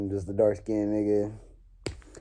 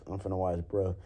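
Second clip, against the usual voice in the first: ordinary talk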